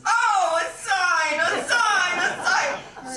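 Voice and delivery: loud, high exultant cries; hammed-up rapture at an omen; acted excited outcry. Contessa after the pigeon's omen, acted overjoyed shouts.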